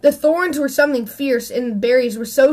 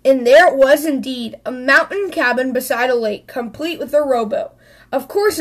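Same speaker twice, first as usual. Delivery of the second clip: ordinary delivery, nothing marked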